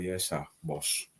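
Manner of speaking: soft, slow, fawning yes sir; bow and scrape with the words; a quiet slow sneer at yes-men; mock servile.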